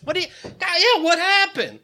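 very high, put-on innocence; mock concern. Culprit asking what happened, mock innocent concern.

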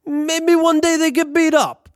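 sly Italian landlord hinting at mischief; thick Italian accent. Retold or imitated. Landlord slyly suggests vandalism to tenant, thick Italian accent. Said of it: "in his thick Italian accent".

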